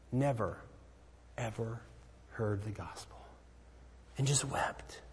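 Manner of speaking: breathy unvoiced whisper, hushed and still; whispered with awe. Real, not acted. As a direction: drop to a whisper, held by the memory. Moved retelling of old man's conversion.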